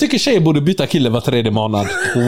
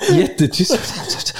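whispered; 6 semitones higher, much slower than usual. Shown as a demonstration. Showing how the girls whispered on the phone, whispering. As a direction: whisper "jättetyst" very slowly. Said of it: "viskar de".

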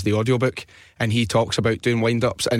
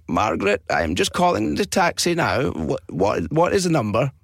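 a hint of a slow, muddled old man; old guy voice; 4 semitones higher than usual. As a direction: lightly play a confused old man on the phone. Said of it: "he heard it was this old guy".

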